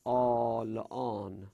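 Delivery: آلان with the first vowel held long; two hamzas merged into one long ā in آلان. Shown as a demonstration. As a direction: draw out the opening ā of آلان, slowly.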